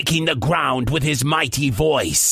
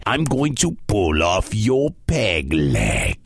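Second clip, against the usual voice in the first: very low giant rumble; decide it in a deep brute's voice; threatening giant; 10 semitones lower than usual. Acted.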